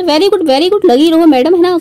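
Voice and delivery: high-pitched mock congratulations; sarcastic praise. Real, not acted. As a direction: cheer her on with obvious sarcasm. Mock praise for the woman criticised.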